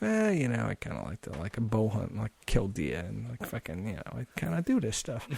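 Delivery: reluctant mumble. Retold or imitated. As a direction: imitate a guy owning up grudgingly, half under his voice. Imitating the guy admitting his hobby.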